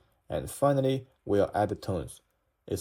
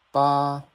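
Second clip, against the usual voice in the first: "ba" high, loud and level; first tone; 4 semitones higher, 6 dB louder than usual. Shown as a demonstration. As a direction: hold "ba" long on one flat high pitch. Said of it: "the tone is super flat".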